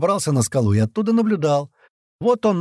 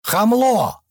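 high, indignant yell; pitched-up angry outburst; yelled insult; 7 semitones higher than usual. A narrator's reading. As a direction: holler it out of a car window. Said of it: "заорал в открытое окно кирпич".

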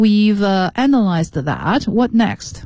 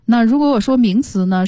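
normal talking voice

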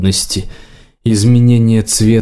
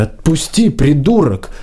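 higher-pitched, restrained indignant demand; mildly exasperated, raised pitch; struggling protest; 3 semitones higher than usual. A narrator's reading. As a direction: demand to be let go without shouting. Girl struggles as hair is pulled.

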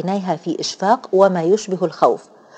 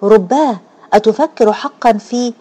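higher, mildly startled exclamation; alarmed cry; 3 semitones higher, 4 dB louder than usual. A narrator's reading. Randa dreads what her fiancé is thinking, mildly alarmed cry. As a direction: cry it out in restrained alarm, breaking off the question. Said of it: "فهتفت بفزع".

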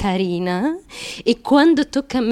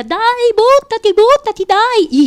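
higher, louder shouted egging-on; imitating a crowd yelling at her to jump; mock cheering; 10 semitones higher, 6 dB louder than usual. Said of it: "qualcuno che mi dica".

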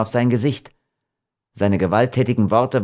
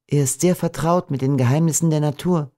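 higher-pitched storybook heroine, earnest; gentle young Beatrice; 7 semitones higher than usual. A narrator's reading.

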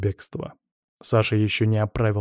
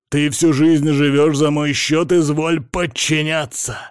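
loud, slow, high-pitched furious yelling; angry shout; 4 semitones higher, 6 dB louder, slower than usual. A narrator's reading. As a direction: shout an order to put someone in their place. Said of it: "заорал на неё Сажен". (гневный крик, громко и с расстановкой)

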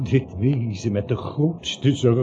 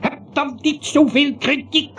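boos; 12 semitones higher, 4 dB louder than usual. Acted. Ruzie tussen beiden.

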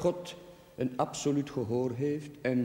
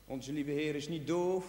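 usual speaking voice

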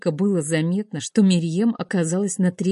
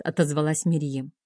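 usual speaking voice

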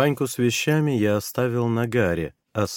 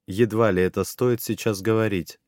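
usual speaking voice

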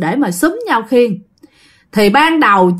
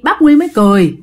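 plain reading voice

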